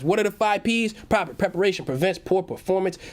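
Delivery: usual speaking voice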